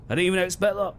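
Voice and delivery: ordinary talk, nothing marked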